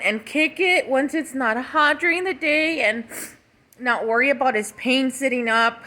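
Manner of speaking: normal talking voice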